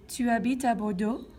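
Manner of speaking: "Tu habites à Bordeaux" with pitch rising at the end; rising question intonation. Shown as a demonstration. Teacher shows students how a statement becomes a question, rising intonation. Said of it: "I'm rising my intonation".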